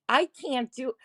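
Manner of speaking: ordinary talk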